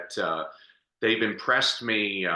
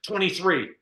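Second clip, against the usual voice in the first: excited; 8 semitones higher than usual. Retold or imitated. Gustavo's excited replies.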